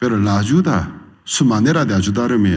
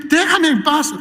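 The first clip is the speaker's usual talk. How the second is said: mock pleading; 5 dB louder than usual. Pleading to be left alone.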